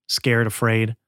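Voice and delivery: usual speaking voice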